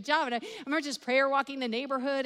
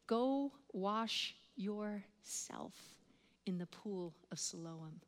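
very slow, soft, spaced-out words; solemn slow command; 7 dB quieter, slower than usual. Retold or imitated. Jesus instructing the blind man, slow solemn command. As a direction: quote it with measured weight, pausing midway.

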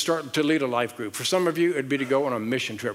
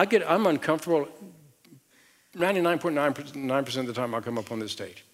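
ordinary delivery

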